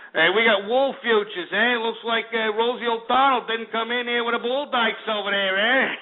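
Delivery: high, hammed-up Jersey wise guy; Jersey Shore accent. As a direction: crack the joke in a mock Jersey Shore accent. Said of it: "no more Jersey Shore crap".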